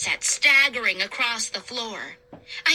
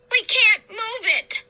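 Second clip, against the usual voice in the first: much higher, louder wail; despairing cry; 9 semitones higher, 3 dB louder than usual. A narrator's reading. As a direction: give up in frightened frustration. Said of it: "so terrified".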